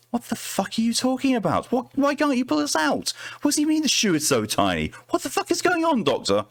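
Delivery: frantic, voice jumping up in fear; panicked yelling. Acted. Trapped partner panics at doctor.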